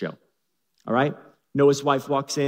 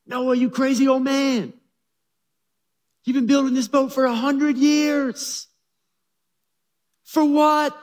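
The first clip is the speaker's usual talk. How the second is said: much higher and louder, jeering; mocking townsfolk; 11 semitones higher, 5 dB louder than usual. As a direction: taunt the crazy old man.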